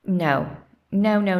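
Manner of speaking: ordinary delivery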